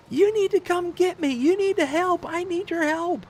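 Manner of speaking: imitating the frantic wife's plea in falsetto; pleading wife. Wife pleading on the phone, retold.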